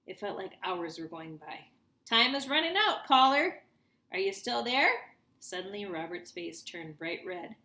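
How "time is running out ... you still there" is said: higher, louder pushing host; urgent DJ. A narrator's reading. Radio DJ pressuring the stalling caller, loud.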